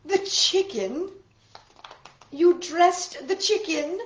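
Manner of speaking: high, loud, slow incredulous questions; disbelieving. Acted. Missus Rogers learning the chicken was dressed, incredulous. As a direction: ask in horrified disbelief.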